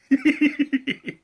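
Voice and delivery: short amused laugh. Real, not acted. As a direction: let out a quick giggle. Amused at own joke.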